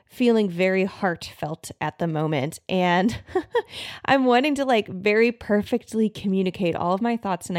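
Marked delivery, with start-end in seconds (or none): 3.2-4.0 breathy little laugh, high; soft nervous laugh. Real, not acted. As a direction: laugh it off shyly. Nervous laugh mid-sentence.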